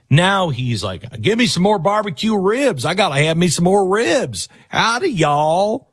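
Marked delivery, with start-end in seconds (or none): 1.2-5.9 higher, hammed-up Texas drawl; mock a fake cowboy Texan; a hammed-up fake Texas drawl, slow on howdy y'all. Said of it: "where did you get the drawl from".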